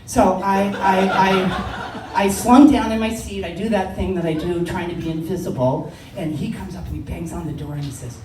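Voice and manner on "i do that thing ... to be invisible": normal talking voice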